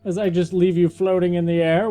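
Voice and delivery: ordinary delivery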